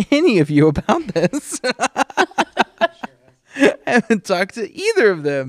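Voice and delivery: laughing through the words; giggling as she admits it. Real, not acted. Laughing at own confession.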